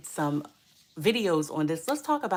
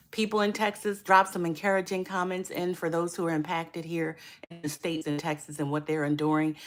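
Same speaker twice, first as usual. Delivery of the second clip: ordinary talk, nothing marked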